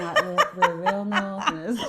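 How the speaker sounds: exaggerated Northern English accent, breaking into laughter. Teasing an old friend about their shared roots, in a broad Northern accent. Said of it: "trying really hard not to slip into an accent".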